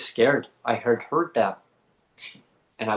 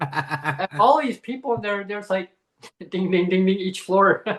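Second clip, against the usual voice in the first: high, giggling ding ding; laughing while recalling the elevator prank; laughing through it; 6 semitones higher than usual. Real, not acted.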